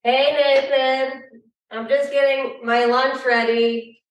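distant voice from across the room; talking from another room; far away. Real, not acted. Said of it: "Is that why you sound so far away?".

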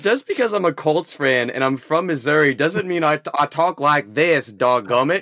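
hammed-up folksy Midwest accent. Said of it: "it sounds better with the Midwest accent".

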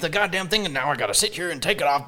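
put-on cranky old-timer complaining about the kids; grumpy old man. Shooter griping about flashlights as a grumpy old man. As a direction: grumble it like a fed-up old man. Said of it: "some kinda old man who's just like".

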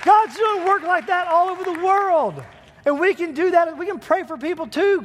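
loud, high shouting preacher, fired up; full-force preaching. Acted. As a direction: shout the praise to the whole room. Preacher erupts after healing clip.

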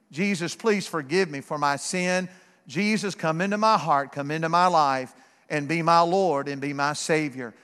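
low, quiet pleading prayer; simple prayer. Retold or imitated. Preacher retells asking Jesus for forgiveness, low humble prayer. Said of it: "praying a very simple prayer".

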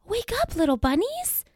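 high gasp then call; mock alarm; gasping wake-up call. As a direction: startle them awake playfully. Waking sleeping bunnies.

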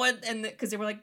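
ordinary talk

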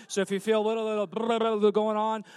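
slurred, numb mouth; talking with numb lips and tongue. Real, not acted. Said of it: "I still can't feel my lips".

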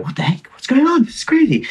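high-pitched bewildered protest; retold alarm. Rider retells being dragged off at a checkpoint, alarmed. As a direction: pitch climbing sharply in acted disbelief.

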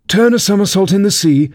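high, excited Mock Turtle cry; giddy high-pitched exclamation. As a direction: call out wildly, voice lifted high. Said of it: "cried the Mock Turtle".

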